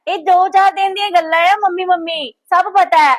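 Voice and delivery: high, loud sneering jab; mock her fake love; scornful anger. Daughter sneers at her sister-in-law's devotion, scornful.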